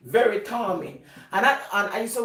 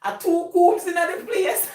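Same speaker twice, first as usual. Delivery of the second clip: high startled cry, slowing down; dad amazed to see his double; surprised dad; 11 semitones higher, slower than usual. Retold or imitated. Dad startled at his double, high surprised cry. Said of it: "he comes and says".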